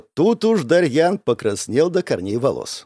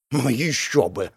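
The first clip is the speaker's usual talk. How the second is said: slow, drawn-out scoff; snorting sarcasm; 3 dB quieter, slower than usual. A narrator's reading. Narrator answering an afterthought invitation, sarcastic. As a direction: scoff it slowly. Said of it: "фыркнул я".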